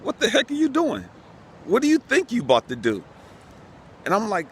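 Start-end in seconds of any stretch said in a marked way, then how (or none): 0.0-3.0 God's voice. Retold or imitated. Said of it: "I could hear God just like".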